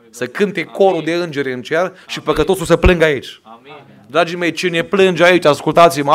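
impassioned Pentecostal preacher at the peak; fervent climax preaching. Acted. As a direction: plead with the congregation at full force.

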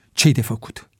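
ordinary delivery

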